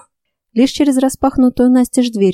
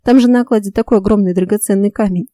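usual speaking voice

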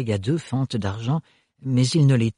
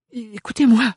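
put-on much higher, urgent young woman; pleading Ophélie; 10 semitones higher, 3 dB louder than usual. A narrator's reading. Ophélie trying to calm her family, higher. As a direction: cut in to calm them.

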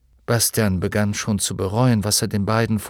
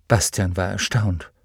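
ordinary delivery

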